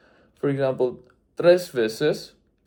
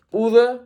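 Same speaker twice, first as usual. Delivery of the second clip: "Pude" said higher and set apart; stressed key word; 7 semitones higher than usual. Shown as a demonstration. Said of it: "there's some meaning changes too".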